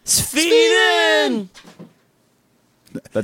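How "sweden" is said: very high, drawn-out screech of the name; piercing falsetto outburst; high mock cry. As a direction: squeal it way up high and stretched. Mock shout startles the cat.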